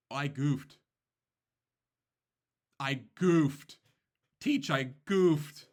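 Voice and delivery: put-on loud, rising wail of a kid who messed up; panicked kid. Student wailing to his teacher over a misplay, loud dismay. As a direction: cry out the mistake, dragging the last one slow. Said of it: "he reads it and goes".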